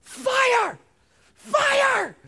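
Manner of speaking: high, loud panicked shout of fire; frantic yell. Retold or imitated. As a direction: shout it like a panicked tenant on the phone. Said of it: "and he yells".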